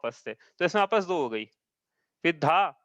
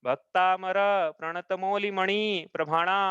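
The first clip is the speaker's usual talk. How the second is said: Bhaktamar प्रणत मौलि मणि प्रभाणा with syllables less drawn out; incorrect verse reading. Shown as a demonstration. Teacher showing how not to read the verse, shorter syllables. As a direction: move through Bhaktamar and the rest without long holds. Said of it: "ऐसा नहीं पढ़ना है".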